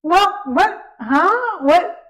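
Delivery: high, loud what-huh-what; mother suddenly hears her son won't go; stunned disbelief. Retold or imitated. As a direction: blurt it out in shock. Mother shocked by refusal.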